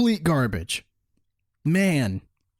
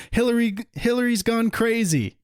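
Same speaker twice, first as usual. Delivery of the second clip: ordinary delivery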